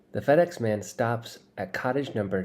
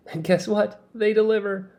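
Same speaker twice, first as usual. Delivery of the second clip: high, loud gleeful reveal; gloating; 12 semitones higher, 5 dB louder than usual. Acted. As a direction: crow it with glee. Jet gloats about delivery.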